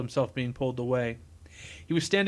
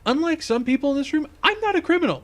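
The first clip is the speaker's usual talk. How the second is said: much higher, louder protest; indignant retort; 12 semitones higher, 5 dB louder than usual. Acted. Engineer defends himself against criminals' doubts, indignant. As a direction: fire back with the pitch way up.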